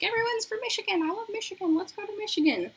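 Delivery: very high-pitched gushing mock voice; hammed-up Michigan fan, way up high; mock enthusiast. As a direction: squeal it in a silly high register. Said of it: "I'm like".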